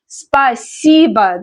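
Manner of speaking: slow Спасибо with the и stressed. Shown as a demonstration. Teacher explains Спасибо stress, drawn-out middle syllable. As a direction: lean long on the middle и of Спасибо. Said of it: "the stress is on E".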